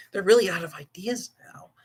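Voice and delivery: a hushed unvoiced aside; half-joking whispered jab. Real, not acted. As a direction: whisper it off to the side.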